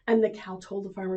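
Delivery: usual speaking voice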